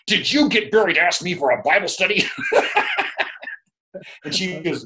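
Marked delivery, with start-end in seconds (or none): 0.1-2.2 lightly voiced husband, pitched higher; a hint of a suspicious husband; quoting the husband. Husband questioning his wife about the Bible study, lightly voiced. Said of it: "Tony came home and said".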